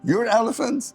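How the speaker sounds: high-pitched retold disbelief; puzzled surprise. Economist reacting to caller's elephant claim, retold surprise. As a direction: echo it back as a baffled question.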